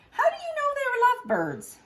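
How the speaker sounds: high, squeaky alarmed question; flustered Gerald. Acted. Gerald asks Piggy about the birds, alarmed high squeak.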